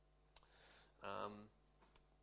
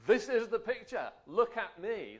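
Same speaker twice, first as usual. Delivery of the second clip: frame calling out; 7 semitones higher, 9 dB louder than usual. Pretend. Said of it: "That's what the frame says".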